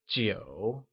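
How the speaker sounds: Jiu dipping low, then rising again; falling rising tone. Shown as a demonstration. Teacher modelling Jiu with a low dipping tone for learners. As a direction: let Jiu sink and curl back up. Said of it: "pronounced with a falling, rising tone".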